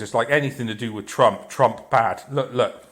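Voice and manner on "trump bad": ordinary talk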